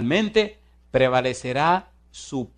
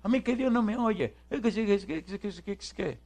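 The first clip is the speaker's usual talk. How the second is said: a hint of a whining woman, high and stammering; mocking whiny complainer; 9 semitones higher than usual. Preacher mimicking a complaining woman, high whiny stammer. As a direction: mimic her stuttering excuse. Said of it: "y vino a quejarse".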